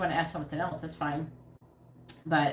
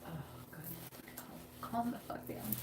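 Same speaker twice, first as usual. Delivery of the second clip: exasperated whisper, much softer; 2 semitones higher, 15 dB quieter than usual. Real, not acted. Owner whispering at the restless cat, exasperated. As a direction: hiss it under your breath at the cat.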